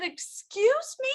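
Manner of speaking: can't believe what she heard; stunned. Retold or imitated. Stunned reply to doctor.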